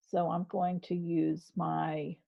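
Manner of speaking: ordinary delivery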